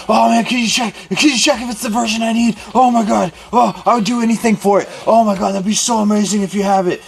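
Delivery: imitating an overexcited kid, high and urgent; freaking out. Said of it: "kid's freaking out, and he goes".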